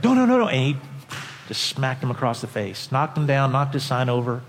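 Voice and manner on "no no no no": high, loud alarmed protest; alarmed crowd. Retold or imitated. Preacher voicing the bus crowd alarmed at the attack. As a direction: cry out as if to stop a fight. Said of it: "people in the crowd said".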